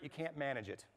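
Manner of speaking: usual speaking voice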